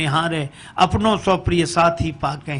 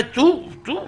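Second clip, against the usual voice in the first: angry snarl; 8 semitones higher than usual. Acted. Angry wife facing the mirror.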